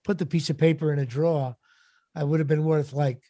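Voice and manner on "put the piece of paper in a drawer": ordinary talk, nothing marked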